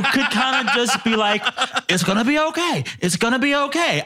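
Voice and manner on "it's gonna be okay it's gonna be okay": very high, squeaky put-on reassurance; mock reassurance. Reporter reassuring self about the election, high mock reassurance. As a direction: pipe it up high, unconvincingly soothing.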